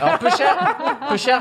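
high, loud laughing stab at a southern "peu cher"; mock southern accent. Shown as a demonstration. Trying out the southern expression, laughing, hammed up. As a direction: ham up the Marseille exclamation while laughing. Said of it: "c'est vraiment rentrer dans un personnage".

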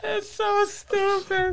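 high, drawn-out giggly voice; amused squeal. Real, not acted. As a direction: squeal it slowly, tickled by the stupidity. Cracking up at a silly bit.

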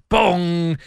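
loud, high bong imitating Big Ben. Host mimics lawyer's answerphone chimes, Big Ben bong. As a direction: boom out a mock clock chime. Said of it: "used to have Big Ben bonging".

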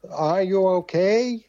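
American accent. Shown as a demonstration. Co-host mocking an imported mental health slogan, American accent. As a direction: put on an over-American accent, slowly. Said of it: "it sounded too American".

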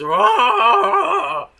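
a very high, loud cartoon rage yell; mock angry roar. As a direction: blow up with a hammed-up roar. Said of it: "gets angry and goes".

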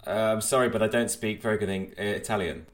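ordinary talk

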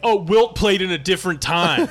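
loud, high know-it-all fan; sports debater. Retold or imitated. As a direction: argue like a barstool expert. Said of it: "It'd be like".

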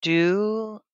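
auctioneer dragging every word with long pauses; slow auctioneer. Acted. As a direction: auction it off at a crawl, pausing between words. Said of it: "I'm the world's slowest auctioneer".

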